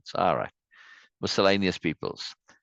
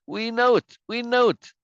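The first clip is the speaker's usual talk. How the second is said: very high, loud mock chorus; high-pitched acted crowd cry; crowd shouting agreement; 14 semitones higher, 5 dB louder than usual. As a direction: shout it back like a stage mob.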